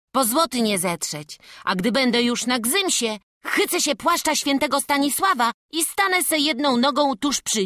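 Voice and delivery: put-on lively boy offering himself; eager boy. Boy volunteers to climb for the master, eager. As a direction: play a keen little climber making his case.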